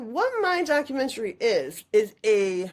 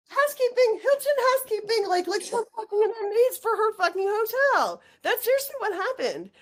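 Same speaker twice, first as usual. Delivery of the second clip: much higher, outraged disbelief; astonished rant, pitched way up; incredulous outburst; 9 semitones higher than usual. Real, not acted. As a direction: spit it out in amazed outrage. Outraged retelling of Paris event.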